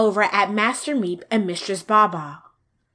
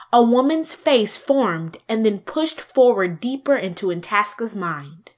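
ordinary delivery

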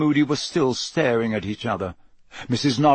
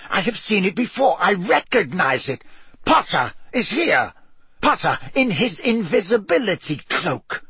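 building accusation; 6 semitones higher than usual. A narrator's reading. Snape's rising accusation.

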